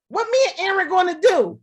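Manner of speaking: quick, high-pitched indignant outburst; acting out Moses losing patience, fast and high; mock-exasperated demand; upset Moses. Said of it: "Moses all upset".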